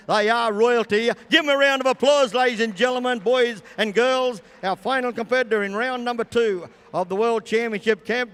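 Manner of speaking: loud, high crowd-rousing call; animal show trainer. Real, not acted. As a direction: whip the crowd up for applause. Announcer rousing crowd for applause.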